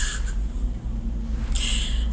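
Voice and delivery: a long burst of real laughter; loud amused laughter; hearty laugh. Real, not acted. Laughing at his own punchline.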